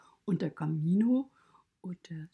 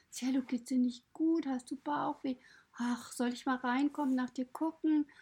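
much higher, worried woman's voice; Petra the owner; 8 semitones higher than usual. Retold or imitated. Owner asking about the nervous pony before hurrying off, much higher and worried. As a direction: fuss anxiously over the sick pony. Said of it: "sagte zu mir".

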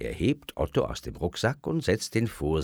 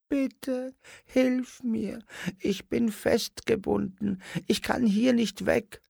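very high, thin pleading dwarf voice; little puppet voice. A narrator's reading. Tied-up puppet dwarf pleads with Simon, very high. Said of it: "antwortet das Stimmchen".

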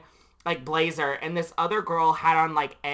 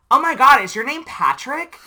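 higher and much louder delighted shriek; mock excited girl; 8 semitones higher, 9 dB louder than usual. Stranger spotting Patrick outside Milk and Honey, excited shriek. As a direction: recognize him like a long-lost friend.

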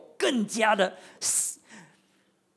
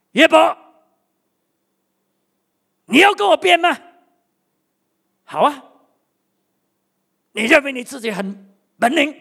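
much higher and far louder booming challenge; God thundering; 7 semitones higher, 9 dB louder than usual. Retold or imitated. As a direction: roar at him like the Almighty. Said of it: "神说". (神高声怒喝、咄咄逼人地质问约伯)

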